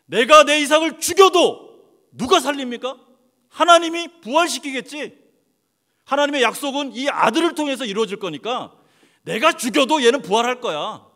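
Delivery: Abraham crying out that God will raise his son; Abraham's confident faith. Acted. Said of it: "아브라함이 왜 자기 이삭을 죽이려고 그랬죠".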